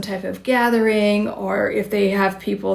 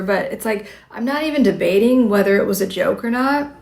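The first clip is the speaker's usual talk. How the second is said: ordinary talk, nothing marked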